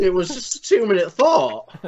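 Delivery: ordinary talk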